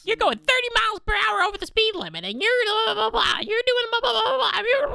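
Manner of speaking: high, whiny blah blah; mocking lecture. Cohost mocking the cop character's lecture, high and whiny.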